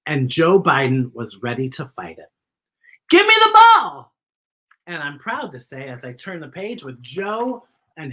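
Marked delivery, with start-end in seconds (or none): 3.1-4.1 much higher and louder, a playful yell; shout. Acted. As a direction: bellow it like a kid on the field. Acted shout in story.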